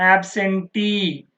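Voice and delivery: absentee slowed, with a long stressed tee; last-syllable stress. Shown as a demonstration. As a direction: stretch the final tee of Absentee. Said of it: "last one". (Absentee లో చివరి tee సాగదీసి ఒత్తిడి)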